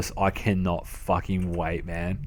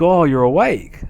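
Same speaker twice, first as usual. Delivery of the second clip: lightly voiced dad, raised and louder; higher, louder quote of dad; imitating dad; 7 semitones higher, 7 dB louder than usual. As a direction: act out dad's loud remark on finding you up. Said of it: "And he'd be like".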